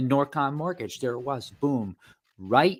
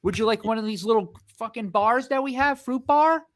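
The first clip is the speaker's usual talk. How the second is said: high, sugary eager-to-please voice; overly friendly clerk; 10 semitones higher than usual. Retold or imitated. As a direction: gush over the silver member. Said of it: "that's what they do".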